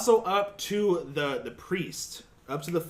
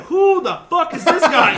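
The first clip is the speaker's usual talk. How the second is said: high, louder, slowed laughing disbelief; shocked whisper-turned-outburst; 8 semitones higher, 9 dB louder, slower than usual. Retold or imitated. Telling friends about the new priest: high, loud, laughing disbelief. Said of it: "and I went".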